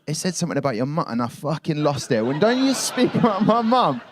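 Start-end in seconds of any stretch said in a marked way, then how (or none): 2.2-4.0 furious yell with a laugh breaking through; angry shout. Retold or imitated. Telling the trainer off for insulting his mum, angry shout.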